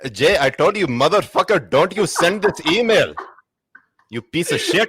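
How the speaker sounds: put-on Pakistani-accented IT tech, high and loud. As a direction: shout at Jay in a Pakistani accent. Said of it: "do a Pakistani I T tech".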